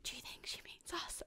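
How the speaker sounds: hushed, voiceless whisper; furtive stage whisper. Retold or imitated. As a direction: whisper it secretly to your companion. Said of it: "And then we're whispering".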